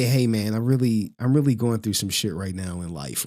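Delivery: low, subdued admission; confiding. Acted. Coach imagining telling viewers his troubles, confiding. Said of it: "if I was to say".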